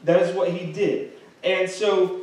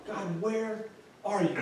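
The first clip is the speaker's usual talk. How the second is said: soft, hushed cry of God, where are you; a hushed, sincere prayer of where are you; quiet plea; 3 semitones higher, 9 dB quieter, slower than usual. Acted. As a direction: ask it softly, almost alone in the dark. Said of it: "that's exactly where you are".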